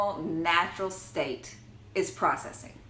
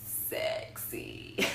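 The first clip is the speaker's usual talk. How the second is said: slow raspy whisper; husky old lady smoker's voice; smoker's rasp; 7 dB quieter, much slower than usual. Pretend. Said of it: "the old lady smoker's voice".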